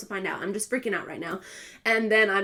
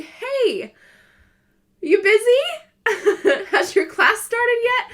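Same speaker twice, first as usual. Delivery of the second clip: nervous laughing call; 12 semitones higher, 8 dB louder, slower than usual. Retold or imitated.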